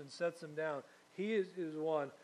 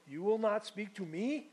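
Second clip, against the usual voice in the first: put-on a rough stab at an Italian accent; 4 semitones higher, 4 dB louder than usual. Preacher voicing Pilate to Jesus, half-done Italian accent. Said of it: "maybe an Italian accent or something".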